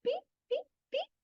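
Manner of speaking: tiny, very high peeps; chick peeping. Acted. Teacher imitating a chick for children, high peeping. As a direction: peep softly like a baby chick. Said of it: "What did chick say?".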